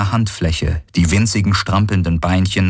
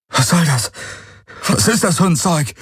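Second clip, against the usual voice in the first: high, agitated questioning; panicked demand; 9 semitones higher than usual. A narrator's reading. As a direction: demand answers, pitch jumping up. Galen rang nach Atem. (aufgebracht und panisch gefragt)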